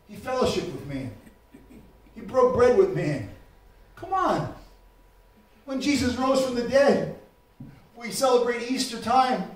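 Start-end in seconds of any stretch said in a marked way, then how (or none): none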